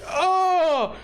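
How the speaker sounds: high, drawn-out "oh" of pain; hammed-up yelp after a fall; acted pained cry. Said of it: "and he's like".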